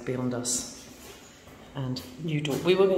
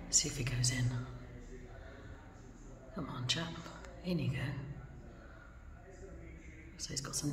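whispering; 6 dB quieter than usual. Real, not acted. Watching a nesting bird from nearby, whispering. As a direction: keep it soft so he won't hear. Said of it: "don't know why I'm whispering".

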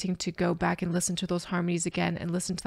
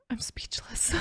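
whispered, awestruck and hushed; 3 semitones higher, 8 dB quieter than usual. Real, not acted. Telling viewers she is speechless, in a whisper. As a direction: breathe the words out, overcome. Overwhelmed by the performance.

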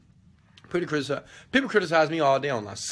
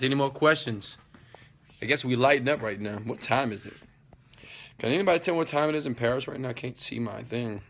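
ordinary delivery

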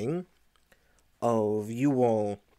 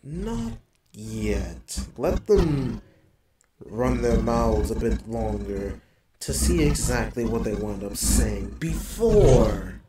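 Diablo; 3 dB louder than usual. Acted. Diablo tells the player to hold off, in an altered creature voice. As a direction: counsel patience in an otherworldly, deliberate voice. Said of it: "the same thing that Diablo said".

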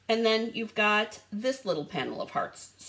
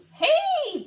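ordinary delivery

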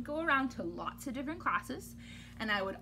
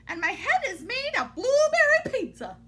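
higher, louder goofy line; silly proclamation; 11 semitones higher, 6 dB louder than usual. Acted. Reader reading a goofy line, high and loud. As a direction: declare it with silly gusto.

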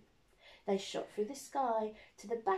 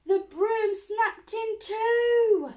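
much higher, louder, slow; dramatic alarm; 12 semitones higher, 11 dB louder, slower than usual. A narrator's reading. Narrator at the climax, alarmed cry. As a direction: cry it out as the broom breaks.

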